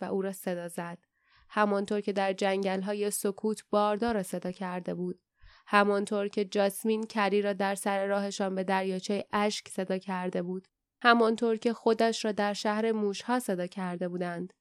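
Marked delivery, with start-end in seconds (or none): none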